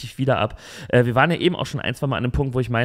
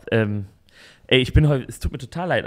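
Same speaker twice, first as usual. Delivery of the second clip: ordinary talk, nothing marked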